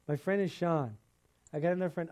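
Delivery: usual speaking voice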